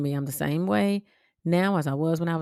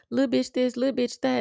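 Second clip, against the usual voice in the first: sing-song imitation of the girls' taunt, pitched up; mocking taunt; 7 semitones higher than usual. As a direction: mimic the bullies teasing her, singsong.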